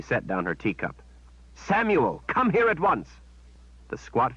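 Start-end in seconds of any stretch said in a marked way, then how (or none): 1.5-3.1 high, commanding summons; imperious call to the servant. Acted. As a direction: order him over at once. Shota summons servant sharply.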